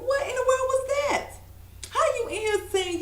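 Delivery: very high-pitched imitation of an appalled therapist; Doctor Jeff's disbelief. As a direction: squeal it in mock outrage. Retells therapist's outraged reaction.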